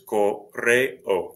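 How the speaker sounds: correo drawn out slowly, co-rre-o; separate syllables. Shown as a demonstration. Teacher breaking down correo for learners, slow separate syllables. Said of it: "The E and the O are separate syllables".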